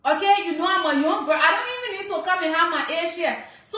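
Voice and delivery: usual speaking voice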